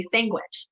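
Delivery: "sandwich" pronounced "SANG-wich"; an ng replacing the nd in "sandwich"; sangwich. Shown as a demonstration. Showing how Spanish people talk, "sandwich" said as "sangwich". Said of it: "Spanish people typically say sandwich".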